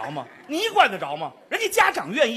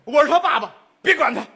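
pushy father; 3 semitones higher, 7 dB louder than usual. Acted.

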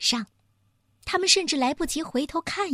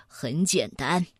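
amused confidence; 8 semitones lower than usual. A narrator's reading. Bear chuckles, confident of his answer.

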